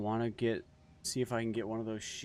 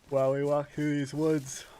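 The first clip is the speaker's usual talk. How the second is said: words stretched by a yawn; 8 semitones higher, 8 dB louder than usual. Real, not acted. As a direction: talk through a big yawn. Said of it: "I don't know why I'm yawning".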